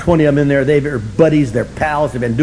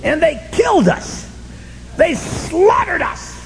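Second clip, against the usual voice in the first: high, slow wail of defeat; hammed-up despair; mock anguished cry; 12 semitones higher, 3 dB quieter, slower than usual. Real, not acted. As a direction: howl it like a wounded loser. Comic outrage at losing.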